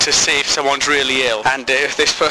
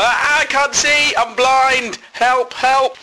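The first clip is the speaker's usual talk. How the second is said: much higher, slow mock panicked cry; 10 semitones higher, much slower than usual. Driver blinded by rain without wipers, hammed-up panic.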